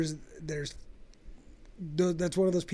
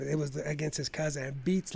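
ordinary talk

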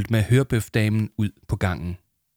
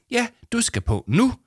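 much higher, brisk call; busy studio assistant. Acted. Headset lady calling Arthur to the studio, brisk. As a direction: hurry him out. Hørebøfdamen voiced.

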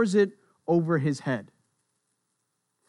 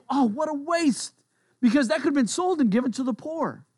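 high-pitched mock dismay; scandalized, voice lifted high; indignant; 8 semitones higher than usual. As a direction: act out a righteous complaint. Disciples' indignant complaint acted out.

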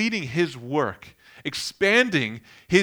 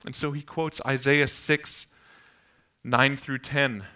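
normal talking voice

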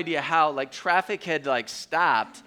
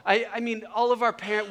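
usual speaking voice

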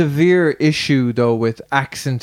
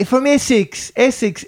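high Essex accent drifting Aussie; Essex accent slipping into Australian; 11 semitones higher than usual. Shown as a demonstration. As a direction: try Essex and slide towards Australian. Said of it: "it went Australian for a second".

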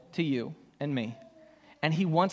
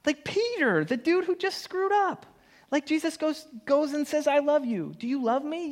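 excited retelling; 10 semitones higher than usual. Acted. Preacher retelling Jesus forgiving Peter.

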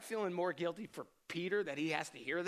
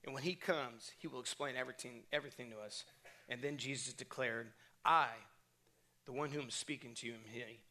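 soft, measured Bible reading; a hushed reading from the Gospel; scripture reading; 4 semitones lower, 5 dB quieter than usual. Acted. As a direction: read the verse quietly and reverently. Reads scripture aloud before prayer.

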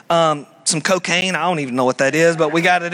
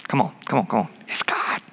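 low, hushed, wheedling coax; coaxing tempter; 7 semitones lower, 5 dB quieter, faster than usual. Acted. Preacher voicing the serpent coaxing, low and hushed. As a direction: lure them quietly to doubt.

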